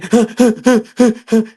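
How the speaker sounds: high mock sobbing gasp; a child's sobbing imitated; imitated sob. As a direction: sob like the crying son. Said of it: "he's still crying".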